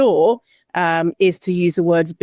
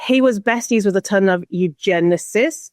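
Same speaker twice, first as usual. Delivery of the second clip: ordinary talk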